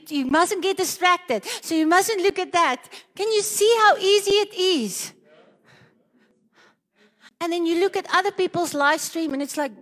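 usual speaking voice

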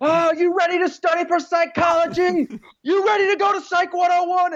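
very high, hammed-up drill-style yelling; high-pitched acted hollering; mock screaming. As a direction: play an over-intense roommate screaming someone awake. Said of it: "waking up screaming in his roommate's ear".

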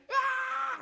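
high mock metal scream; imitated scream. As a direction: let out a hammed-up rock scream. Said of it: "Like, screaming and stuff".

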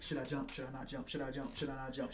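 low, very soft wavering self-questioning; imitated inner debate. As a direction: voice someone's private back-and-forth, well under normal volume. Acts out inner back-and-forth debate.

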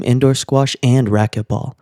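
plain reading voice

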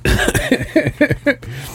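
high-pitched, soft chuckling; amused giggle. Real, not acted. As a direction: laugh along at the remark. Laughs at co-host's remark.

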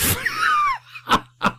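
high squealing laugh; shrieking laugh. Real, not acted. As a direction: burst into a shrill laugh. Laughing at Piggy voice.

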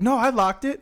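high, loud mock-British protest; mock British accent. Husband answering his wife about the gate, mock British accent. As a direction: insist as the husband in put-on British.

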